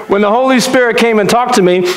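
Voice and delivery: usual speaking voice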